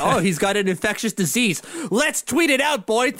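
high-pitched, hammed-up gleeful reporter; mock eager discovery, pitch climbing sharply; Steve Simmons. Podcaster imitating reporter flipping through medical charts, gleefully eager. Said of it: "Steve Simmons over here is, like".

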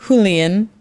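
tentative "Julian" as HOO-lee-un; Spanish J. Shown as a demonstration. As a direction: try "Julian" with an H, slightly questioning. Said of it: "I guess the J's do make a huh".

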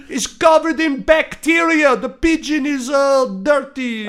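loud, high, slow Dutchman impression; hammed-up Dutch man yelling a warning; shouting old Dutchman. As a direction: holler down from a balcony as a Dutch neighbour. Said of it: "the Dutchman again".

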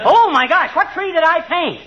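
high startled cry; sudden horrified realization; shocked. Real, not acted. Realizes he painted wrong tree.

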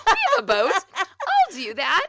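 high, loud, overeager volunteer voice; mock herself as a keener; eager keener.

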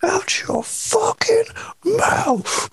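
high, strained, slow whispered shout; mock Will Smith yell. Cohost parodies the Oscars outburst in a strained voice. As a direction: squeeze it out like a choked-up holler.

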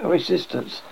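ordinary delivery

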